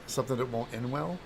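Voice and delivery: ordinary talk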